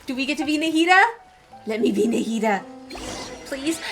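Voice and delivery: ordinary talk, nothing marked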